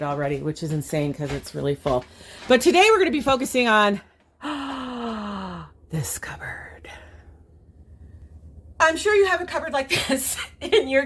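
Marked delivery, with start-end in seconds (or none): 4.4-5.6 dramatic gasp. Acted. Dramatic reveal of the cupboard. 5.9-6.9 hushed reveal whisper; dramatic whisper. Acted.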